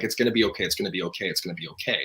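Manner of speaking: mock-soothing, fading to a murmur; soothing reassurance. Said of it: "and she's like".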